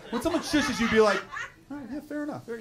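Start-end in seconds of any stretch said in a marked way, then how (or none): 1.7-2.6 high, soft, backing down; small high apologetic voice; meek concession. Acted. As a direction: give in meekly after being shushed. Models backing down when shushed.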